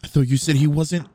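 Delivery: sudden high panicked yelling; panicked shout. Acted. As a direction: shout in alarm, caught out. Caught, boyfriend arrives.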